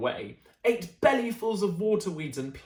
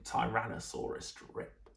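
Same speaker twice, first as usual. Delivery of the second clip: ordinary delivery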